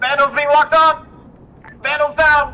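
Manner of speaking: loud, high alarmed callout; urgent shout. Real, not acted. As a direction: yell the warning over the radio. Aircraft locked and going down.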